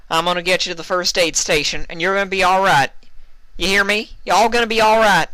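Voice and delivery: high, loud and quick reassuring cowpony; Applejack, urgent. Acted. Applejack carries the wounded Vinyl, loud, fast reassurance. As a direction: rush the promise to keep her going.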